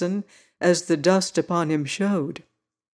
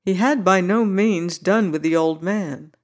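ordinary delivery, nothing marked

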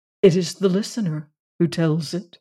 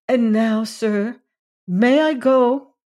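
higher, polite lilting request; childlike woman; 5 semitones higher, 3 dB louder, slower than usual. A narrator's reading. Woman asking Randolph to go, childlike voice. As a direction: ask simply, like a child seeking leave.

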